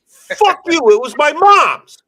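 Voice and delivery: shouting back at people who mock his late mom's bag, furious; angry outburst. Real, not acted. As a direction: snap back at the mockers, fierce and loud. Ranting at people mocking bag.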